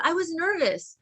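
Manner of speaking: plain reading voice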